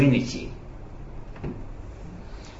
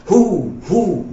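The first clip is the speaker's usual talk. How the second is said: put-on high, loud hooting drunkard; drunk man's hoot; 7 semitones higher, 6 dB louder than usual. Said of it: "instead of saying ah, he said".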